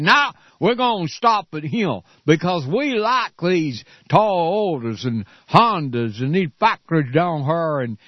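mock Southern senator. Host imitating Republican senators dismissing Detroit, slow mocking Southern drawl. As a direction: drawl it out like a smug good old boy. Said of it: "You think I'm mocking these people?".

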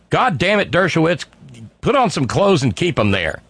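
high, exasperated mock yell; mock screaming. Imagined lawyer to Dershowitz about his clothes, mock screaming. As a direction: imitate a lawyer yelling at his client. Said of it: "probably screaming at the top of his lungs".

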